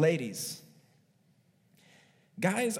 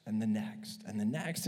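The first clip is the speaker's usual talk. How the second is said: softer, whispered fade of the list; 8 semitones lower, 6 dB quieter than usual. Acted. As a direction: let the list die out in a whisper. Trailing off the list.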